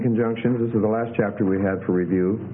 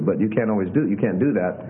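ordinary delivery

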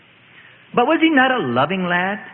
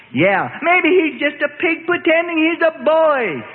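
leering demon shout; 10 semitones higher than usual. A narrator's reading. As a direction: spit it out like a possessed lad. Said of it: "a leering demon shouts".

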